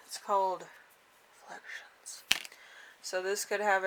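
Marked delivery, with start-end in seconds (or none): 1.4-2.2 breathy whispered card reveal. Real, not acted. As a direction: whisper it like a secret. Dramatic reveal of the card name.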